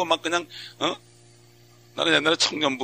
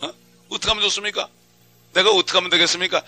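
higher, urgent crying out to the Lord; earnest plea; 6 semitones higher, 4 dB louder than usual. Pretend. Preacher acting a believer begging God for guidance. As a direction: plead with God, pressing.

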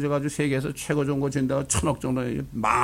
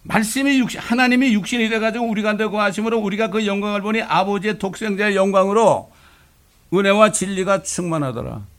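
higher, solemn reading of the verse; raised pulpit reading of 은혜와 진리가 충만하더라; scripture recitation; 7 semitones higher, 4 dB louder than usual. Acted. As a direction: intone the Bible passage like a preacher.

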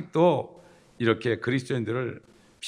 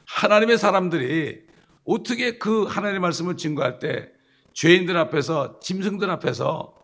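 raised, louder pulpit preaching with punch; fiery preaching; 4 semitones higher, 4 dB louder than usual. Acted. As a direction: thunder it at the congregation. Preaches at full force.

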